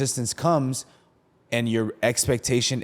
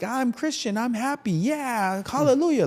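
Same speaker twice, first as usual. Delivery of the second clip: high, squeaky cheerful mock voice, much higher; 12 semitones higher than usual. Mocking fake-happy Christians, high squeaky cheer. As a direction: mimic a fake-happy believer, pitched way up. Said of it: "everyone's like".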